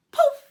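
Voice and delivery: loud high poof; magic poof. A narrator's reading. Magic effect in story.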